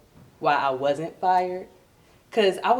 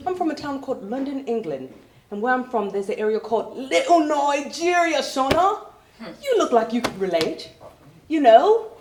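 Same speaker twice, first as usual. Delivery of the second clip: mock British woman, pitched up; British coworker; 7 semitones higher, 4 dB louder than usual. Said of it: "she was like".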